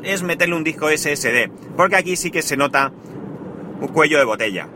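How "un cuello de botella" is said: usual speaking voice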